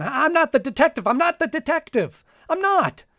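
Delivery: frantic repeated protest, pitch rising; panicked denial. Acted. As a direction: beg them, voice climbing in terror. Terrified man repeating denial.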